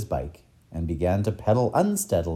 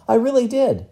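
put-on high, loud childlike insistence; insistent cry; 14 semitones higher, 6 dB louder than usual. Boy assuring the professor he really did, insistent cry. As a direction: insist at raised pitch and volume. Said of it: "cried Tom".

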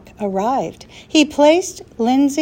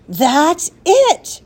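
higher, eager outburst; a boy jumping at a good idea, crying out; 6 semitones higher, 4 dB louder than usual. A narrator's reading. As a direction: cry it out, delighted. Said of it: "cried Tom".

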